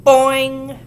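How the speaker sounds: loud high boing through a mask; muffled yell. A narrator's reading. Ramona yelling through her rubber mask. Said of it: "yelled a muffled".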